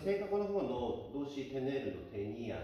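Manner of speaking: ordinary talk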